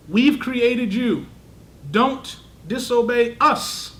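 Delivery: slow, loud, weighty declaration; commanding voice of God. Shown as a demonstration. Teacher voicing the divine command to the class, slow and loud. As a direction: proclaim it slowly with full authority. Voicing Allah's words, emphatic.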